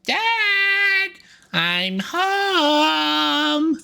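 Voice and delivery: put-on very high, eager child voice; excited kid penguin. Poncho bursting into the shop calling his parents, very high child voice. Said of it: "and he's like".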